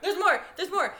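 hyped announcer. Acted. Announcer hype repeat.

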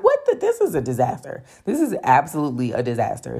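a hammed-up cry of dismay; exasperated disbelief. Offered a second tiny noodle.

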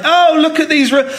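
high, projected mock complaint; imitated loud shouting. Woman shouting about someone's children, imitated indignation. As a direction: call it out high, as a scandalised stranger. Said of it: "shouting really loudly".

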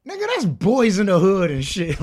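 acted heckler shooting down a pitch; loud mock put-down. Acts friend shooting down movie idea.

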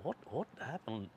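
slow, hushed groping for memory; re-enacted dazed, halting question; trying to think. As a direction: murmur it slowly, piecing the night together. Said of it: "I'm trying to think".

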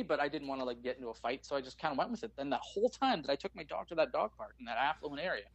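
ordinary talk

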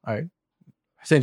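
ordinary talk

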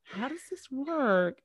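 baffled, drawn-out wondering; mock bafflement. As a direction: draw it out in exaggerated wonder. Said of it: "and it's like".

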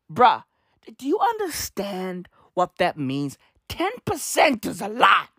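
normal talking voice

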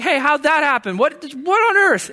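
high, loud disbelieving question; shocked friend. Retold or imitated. Mock-shocked friend asking how she got pregnant. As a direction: demand to know how it happened.

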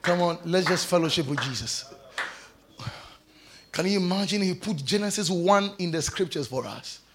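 low, soft reverent delivery; quiet devotional murmur; hushed, prayerful worship. Real, not acted. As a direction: lead them gently into prayer, voice dropped low. Hushed worship and prayer after preaching.

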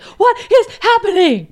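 loud, high, slow panicked yell; mock panicked yell. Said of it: "I would be yelling".

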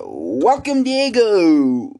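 loud, drawn-out show introduction; big mock talk-show host call; announcer's welcome. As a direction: swoop up high and stretch out the name. Calls out a guest welcome.

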